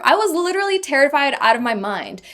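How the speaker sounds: ordinary delivery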